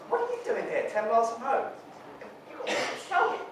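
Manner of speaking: high, accusing disbelief; accusing shock. Acted. Son confronts mother.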